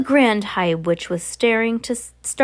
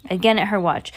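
ordinary delivery

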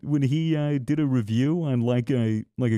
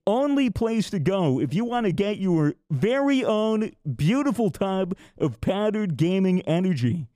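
upbeat sponsor pitch; ad read; 4 semitones higher than usual. Acted. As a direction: sell it like a commercial.

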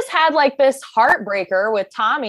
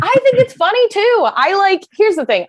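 excited; 14 semitones higher, 8 dB louder than usual. Real, not acted. Excited agreeing after a laugh.

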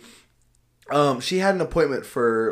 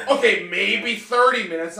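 imitating grudging concession; 8 semitones higher, 4 dB louder, slower than usual. Retells the lady grudgingly backing down.

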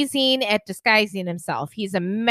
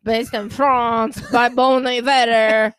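mock French; 3 dB louder, slower than usual.

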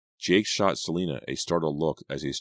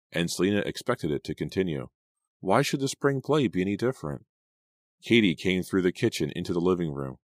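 usual speaking voice

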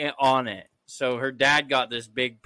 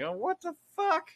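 startled; 13 semitones higher, 5 dB quieter than usual. Retold or imitated. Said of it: "I would have been like".

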